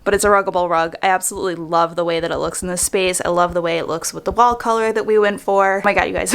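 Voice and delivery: ordinary talk, nothing marked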